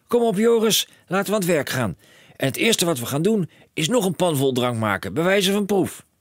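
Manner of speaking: high and loud, racing with plans; a dad who sees a fortune; excited cry. A narrator's reading. Father telling his son of riches, high excited call.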